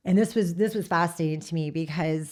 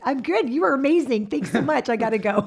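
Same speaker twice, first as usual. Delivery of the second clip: higher and louder, gushing and breezy; mock cheery goodbye; 6 semitones higher, 7 dB louder than usual. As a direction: brush the therapist off with bright enthusiasm. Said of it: "I was like".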